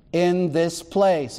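"in this place" drawn out slowly, with weight on "place"; slow emphasis on place. Shown as a demonstration. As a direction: lean hard into "this place". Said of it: "he's emphasizing the location".

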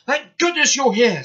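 loud, high-pitched relief; breathless-with-relief exclamation; relieved. A narrator's reading. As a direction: burst out with urgent gratitude. Workmen rescued from a rockfall.

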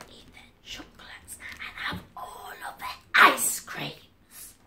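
voiceless secret whisper of a sneaky plan; whispered thoughts. Pretend. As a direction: whisper it like scheming in your head. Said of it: "you think in your mind".

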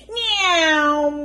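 high, loud cat meow; a child playing at being a cat; playful meow. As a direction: meow like a kitten. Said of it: "went Baby Bear".

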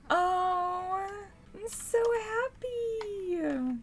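high, slowly stretched squeal; overjoyed gushing. Real, not acted. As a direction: draw it out, melting with happiness. Delighted at the engagement.

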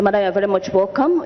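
ordinary talk, nothing marked

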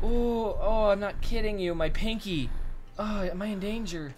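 high, shaken, still in pain; shaken and hurting. Real, not acted. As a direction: catch your breath after the fright. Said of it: "my hand hurts so much".

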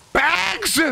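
"Bags?" squeaked up very high in disbelief; incredulous Bags. Shown as a demonstration. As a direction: act like the word Bags offends you.

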